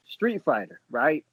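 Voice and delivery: ordinary delivery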